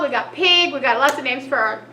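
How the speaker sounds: ordinary delivery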